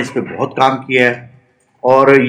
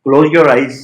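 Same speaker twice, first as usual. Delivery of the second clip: guided meditation; 4 dB quieter than usual. Real, not acted. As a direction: lead a body scan one short phrase at a time.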